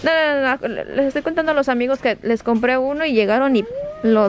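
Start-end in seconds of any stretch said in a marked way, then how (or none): none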